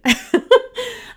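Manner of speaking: high laugh through tears; a sudden high laugh that breaks through the tears; teary laugh. Real, not acted. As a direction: laugh it off while crying. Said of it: "I'm crying".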